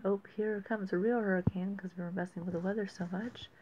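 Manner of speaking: ordinary talk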